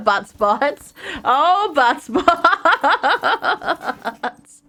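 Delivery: silly word through laughter; giggling. Real, not acted. Streamer giggles over a silly word, laughing through it. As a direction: laugh while saying it.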